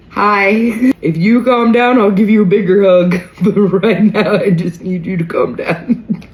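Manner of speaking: very high, loud, awkward sing-out; hammed-up nervous pleading, laughing through it; acted-out uncomfortable. Greeting an over-excited fan, acted-out discomfort. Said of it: "I get visibly uncomfortable".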